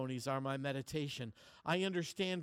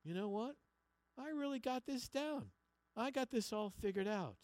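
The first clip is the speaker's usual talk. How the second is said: higher, lightly voiced self-satisfied thinker; mock smugness; 9 semitones higher than usual. Pastor voicing an educated man's pride, light mock smugness. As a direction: play a smug learner, lightly. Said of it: "you start thinking".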